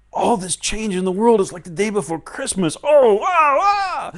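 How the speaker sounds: high, loud thrill rising into a squeal; giddy excitement. Acted. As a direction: gush like a kid before Christmas, then squeal. Acting pre-Christmas thrill.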